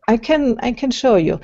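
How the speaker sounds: normal talking voice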